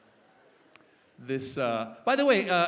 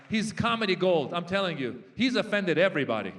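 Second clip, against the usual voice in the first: ordinary talk, nothing marked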